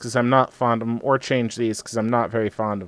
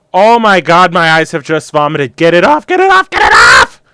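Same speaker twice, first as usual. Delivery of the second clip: horrified shouting; phobic panic; 3 semitones higher, 12 dB louder than usual. Real, not acted. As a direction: yell in disgusted horror. Hideous paint job, mock horror.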